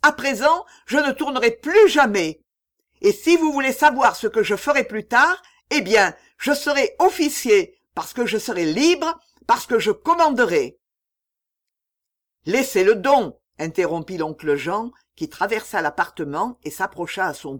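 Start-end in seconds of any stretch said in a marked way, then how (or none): 0.0-10.8 high, loud defiant declaration; a boy proclaiming his freedom; defiant outburst. A narrator's reading. Enfant défie ses oncles. 12.5-13.4 firm interruption. A narrator's reading. Oncle Jean intervient.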